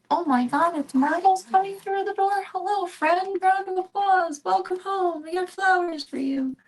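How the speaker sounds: high, loud gushing welcome; mock excited welcome. Cats greeting Marbles at the door, mock gushing. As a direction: gush like the cats throw a party.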